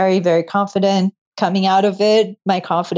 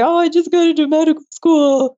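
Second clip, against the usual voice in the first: higher, teary retelling of the news; retold tearful news; 8 semitones higher than usual. Telling the receptionist her news, still tearful. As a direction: relive blurting it out through tears. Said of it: "And I was just crying".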